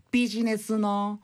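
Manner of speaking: a hint of a food reporter's gush; light take on a TV gourmet catchphrase; 彦摩呂. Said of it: "彦摩呂みたいに言わないでください". (彦摩呂風のグルメリポート口調)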